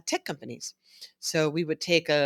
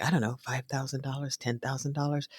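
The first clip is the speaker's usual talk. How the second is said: soft, fast unsure guessing; guessing girls; 2 semitones lower, 7 dB quieter, faster than usual. Retold or imitated. Girls guessing the scientist's salary, soft and unsure. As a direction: mumble a kid's guess. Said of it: "they're like".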